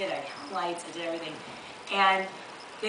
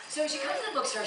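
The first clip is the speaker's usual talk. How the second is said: ordinary talk, nothing marked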